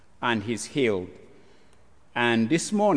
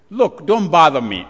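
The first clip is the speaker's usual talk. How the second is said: sharp, loud dismissal; loud brush-off; 3 semitones higher, 11 dB louder than usual. Acted. Jesus brushing off the pleading woman, loud and curt. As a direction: wave her off loudly.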